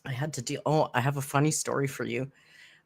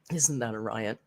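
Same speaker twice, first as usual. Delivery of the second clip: quieter, lower repeat; 6 semitones lower, 6 dB quieter than usual. Shown as a demonstration. Host repeating the line to herself, softer and lower. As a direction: lower and softer echo of "Isn't that a riot?".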